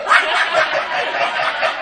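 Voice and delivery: an airy, voiceless laugh; a real laugh, mostly breath; breathy laugh. Real, not acted. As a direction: laugh under the breath, loud. Laughing at the teacher's teasing.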